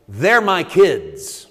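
loud, high, straining sermon climax; preaching at full force. Acted. Preacher driving the point home to the congregation, full-force preaching. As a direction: preach it with everything you have.

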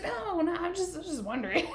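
high, placating mock panic; imitated anxious dad; nervous backtracking. Scared dad backtracking to girl.

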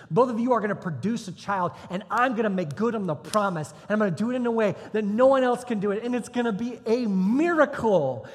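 loud, high, insistent promise; emphatic proclamation. Acted. God promises childless Abraham a child, emphatic proclamation. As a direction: build to a big announcement.

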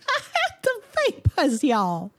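very high, fainting Southern belle; mock-distressed Southern lament. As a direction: swoon in a squeaky-high Southern accent. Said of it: "This is [name]'s only character voice".

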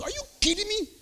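high disbelieving outburst; incredulous. Retold or imitated. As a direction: throw it back in disbelief. Outraged at nurse.

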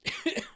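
sharp high choking cough; real cough, caught in the throat; coughing fit. Real, not acted. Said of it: "I'm dying".

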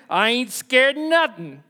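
put-on playful Texan frontier bravado accent; tough Texan cowboy accent. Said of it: "we get this tombstone type accent".

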